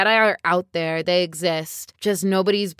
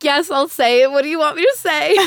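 high, loud, giddy reply; gushing mock compliance; 8 semitones higher, 5 dB louder than usual. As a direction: play an overeager fan giving in at once. Gushing mock reply to film character.

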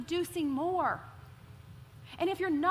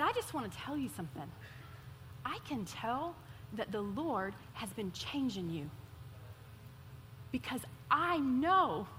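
lightly voiced friend, soft and sincere; tender quoted friend; 5 semitones lower, 6 dB quieter than usual. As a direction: pass on her kind words gently. Friend's heartfelt words at dinner.

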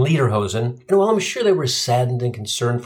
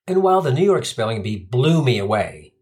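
usual speaking voice

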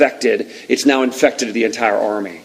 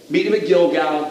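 ordinary talk, nothing marked

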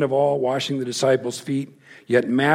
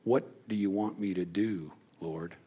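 hushed surrender; 4 semitones lower, 10 dB quieter than usual. Retold or imitated. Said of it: "this is what he says".

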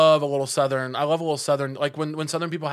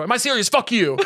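lightly voiced bratty boy, higher and louder; ten-year-old boy act; 7 semitones higher, 3 dB louder than usual. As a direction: play at being a cheeky ten-year-old. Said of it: "I just act like a ten-year-old boy".

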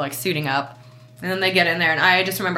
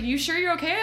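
high, concerned doctor's question; pitched-up concerned quote; worried doctor; 10 semitones higher, 4 dB quieter than usual. Retold or imitated. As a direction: play the doctor checking on her. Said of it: "He's like".

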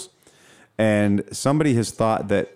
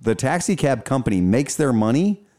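normal talking voice

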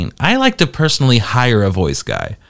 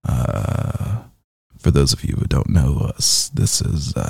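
much lower and much softer, slow crooning impression of "Hey guys, welcome"; silky smooth voice; 8 semitones lower, 7 dB quieter, slower than usual. Host mocking the guest's smooth intro voice, low and soft. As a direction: purr the intro like melted butter, low and hushed. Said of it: "His voice is so silky smooth".

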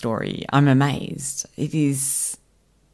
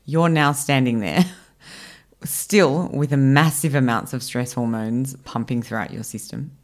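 ordinary talk, nothing marked

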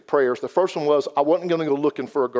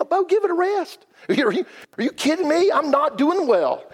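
much higher, strained voice, slowing down at the end; a put-on pleading whine of someone at the end of his rope; exasperated retort; 10 semitones higher than usual. Retelling friend's exasperated reply.

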